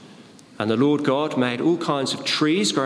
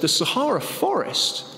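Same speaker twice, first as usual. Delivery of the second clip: higher, disbelieving question; incredulous question; 6 semitones higher than usual. Acted. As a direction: echo it back in disbelief. Joke, boss questions the answer.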